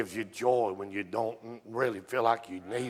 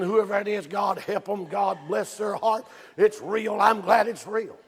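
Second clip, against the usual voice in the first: excited; 10 semitones higher, 7 dB louder than usual. Retold or imitated. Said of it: "And I'm saying".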